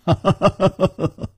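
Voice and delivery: high, rapid staccato ha-ha laugh; hearty laugh. Acted. Chu greets Nangong, high hearty laugh. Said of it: "楚留香哈哈一笑".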